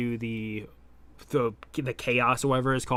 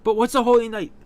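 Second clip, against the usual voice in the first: startled question; 9 semitones higher, 7 dB louder, faster than usual. Acted.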